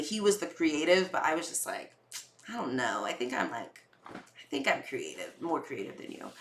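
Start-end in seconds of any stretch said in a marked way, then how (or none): 2.5-6.3 low, softer muttered self-quote; a hushed, grumbling aside; quiet retold retort. To boyfriend, a hushed low retort. Retelling her own sulky reply.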